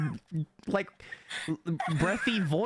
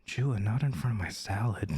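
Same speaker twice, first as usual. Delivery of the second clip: much lower, suggestive delivery; 7 semitones lower than usual. Shown as a demonstration. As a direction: drop it low, as if flirting. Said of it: "maybe don't use that voice then".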